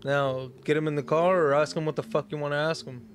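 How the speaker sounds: ordinary talk, nothing marked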